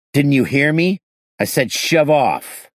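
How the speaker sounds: loud, high angry rebuff. Acted. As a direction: snap at him to back off. Shoving the owner away angrily.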